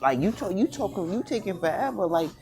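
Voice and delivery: high, strained outraged complaint; exasperated rant. Real, not acted. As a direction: vent at their endless dawdling. Ranting about people wasting time.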